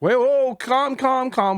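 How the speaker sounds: high, urgent mock appeal; hasty calming. Player calming a suspicious camp on arrival, urgent.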